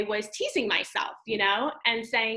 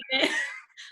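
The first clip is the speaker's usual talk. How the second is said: high laugh. Real, not acted. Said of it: "And I start laughing".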